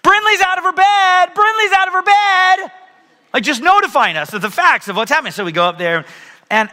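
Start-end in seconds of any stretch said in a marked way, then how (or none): 0.0-2.7 put-on much higher, louder kid voice tattling; hammed-up little boy calling out his sister; child yelling. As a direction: shout it like a rule-follower kid announcing the facts. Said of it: "Charlie go".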